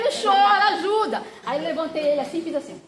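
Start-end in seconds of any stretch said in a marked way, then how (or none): 0.0-1.2 retold urgent order. Ordering crying sister to help.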